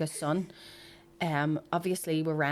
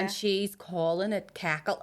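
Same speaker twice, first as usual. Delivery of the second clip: ordinary talk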